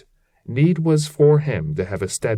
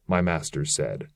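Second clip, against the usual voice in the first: plain reading voice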